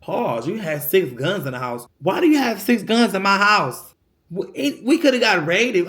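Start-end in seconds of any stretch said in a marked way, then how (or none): 2.0-5.9 louder, indignant demand; outraged. Real, not acted. Venting to viewers about the mom's guns, indignant and loud. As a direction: throw the question at her, fed up.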